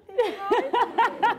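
real laughter breaking into the line; laughing. Real, not acted. Sister recalling the Harry Potter ride, laughing.

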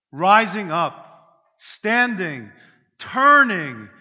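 slow, loud, punched words; preaching climax. Real, not acted. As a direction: build the climax word by word.

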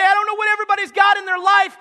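high loud searching plea; loud seeker. Acted. Visitor at church asking about Jesus, loud. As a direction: call out, searching. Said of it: "you say".

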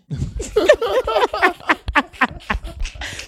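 high-pitched laugh. Real, not acted. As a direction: burst of high giggling. Laughs at own story.

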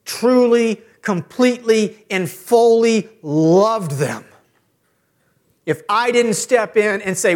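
high, loud, building preacher's cry; impassioned preaching. Acted. Father preaching to fathers about discipline, building to an impassioned cry. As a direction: climb with each word to a call.

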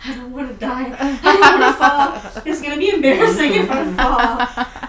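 loud, high, half-laughing panic; nervous rider clutching the handlebars, loud laughing panic; mock panic. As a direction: ham up the terror of falling. Clinging to handlebars in fear.

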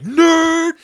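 loud mocking shout; yelling nerd. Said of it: "and then I'm like".

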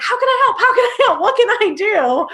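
bouncy, laughing, keen to please; imitating her overeager younger self; eager offer of help.

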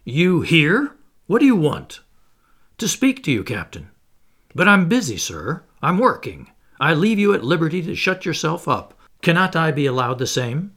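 captain, interrupted at work, rough and annoyed. Acted. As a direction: bark at the intruder, irritated. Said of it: "and said roughly".

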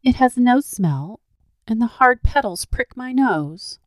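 put-on high, slow scornful little girl; girl rejecting the golden rose with disdain; contemptuous child. A narrator's reading. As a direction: sulk out the complaint. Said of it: "tossing it contemptuously away".

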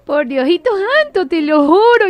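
high, slow mock oath; pleading mock president. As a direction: swear it like a hurt politician. Mocking president swearing he worked.

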